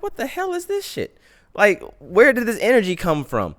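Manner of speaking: high-pitched baffled outburst; shocked high-voiced reaction to the driver's welcome; bewildered. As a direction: squeak it out in disbelief.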